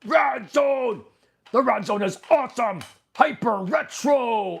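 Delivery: high goofy puppet voice shouting. Acted. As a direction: cheer it as a hyper cartoon puppet. Puppet speaking, voiced character.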